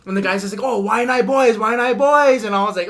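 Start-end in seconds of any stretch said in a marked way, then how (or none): 0.6-2.4 imitated rallying cry. Mimics rival group's rallying cry in brawl.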